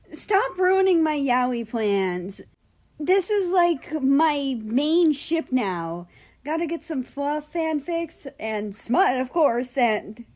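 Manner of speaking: high, loud, gushing fangirl voice; fangirl excitement. A narrator's reading. Japan gushing to America about her favourite ship. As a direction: rave about your ship with giddy glee.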